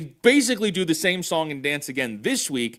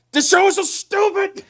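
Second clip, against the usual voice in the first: exasperated; 14 semitones higher than usual. Real, not acted. Exasperated at the show.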